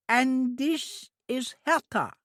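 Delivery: put-on high, slow simple girl's voice; an eager, innocent young woman; high, drawn-out childlike voice; childlike Trudy. A narrator's reading.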